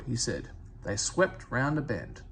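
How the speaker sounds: ordinary delivery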